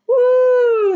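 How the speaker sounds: high, loud joyful woo; excited whoop. Pretend. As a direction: cheer like riding the waterfall. Boat goes over waterfall.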